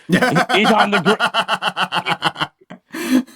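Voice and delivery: hammed-up Boston-style warning; Adam Sandler as Oswald warning. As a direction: call out the grassy knoll in mock panic. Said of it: "He's like".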